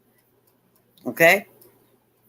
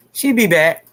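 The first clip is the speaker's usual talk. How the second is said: called out louder; 4 dB louder than usual. Real, not acted. Bestie calling after her laughing cohost, louder call.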